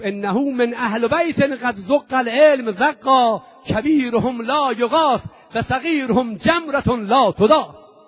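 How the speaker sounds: slow loud pulpit chant; slow declaimed Arabic. Acted. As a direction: declaim each phrase weightily. Yazid's Arabic words recited.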